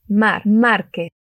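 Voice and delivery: Mar, Márquez said slowly with a trilled R; slow teacher demo of a rolled R in Márquez. Shown as a demonstration. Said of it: "La R suena un poco vibrante".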